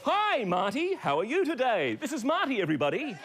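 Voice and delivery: chirpy showman greeting Marty; ventriloquist host voice. Acted. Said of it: "ventriloquist act begins".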